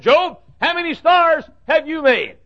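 high, booming question to Job; God taunting Job from above; God's challenge. Acted. God voiced asking Job.

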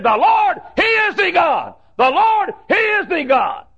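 high shouted declaration; triumphant proclamation. Acted. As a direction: proclaim it to the crowd. Elijah proclaiming to prophets.

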